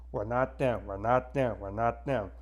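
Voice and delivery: normal talking voice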